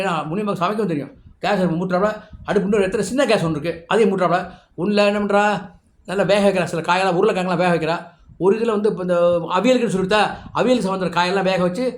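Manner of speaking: ordinary delivery, nothing marked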